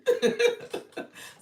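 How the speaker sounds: loud high-pitched burst of laughter. Real, not acted. Laughing at a viewer's wit.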